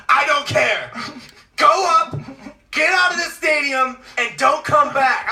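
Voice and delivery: loud high commanding shout; stern order. Retold or imitated. Cop ordering Logan out of the stadium, loud shout. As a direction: order him out slowly and firmly. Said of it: "She goes".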